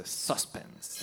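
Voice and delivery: suspense whispered, no voice. Shown as a demonstration. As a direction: breathe suspense out as a secret.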